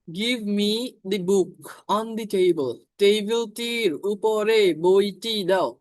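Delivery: very slow example read; teacher reading the example sentence aloud; slow dictation. Shown as a demonstration. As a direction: dictate it word by word. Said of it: "example sentence".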